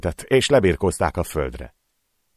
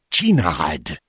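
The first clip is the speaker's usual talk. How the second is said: hissed urging. Acted. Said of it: "sziszegte az egyik kopasz".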